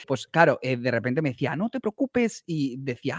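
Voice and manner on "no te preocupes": put-on high-pitched Mexican accent, lightly done; a quick imitation of the cook's Mexican accent. Cook soothing the guest about the spice, light Mexican accent. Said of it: "si he hecho el acento mexicano".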